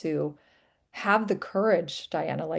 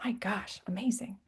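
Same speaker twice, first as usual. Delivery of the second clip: breathy whispered awe; awed whisper; 7 dB quieter than usual. Real, not acted. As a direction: hush it, almost to yourself. Awed reaction to a guest's story.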